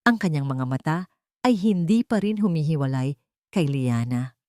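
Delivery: usual speaking voice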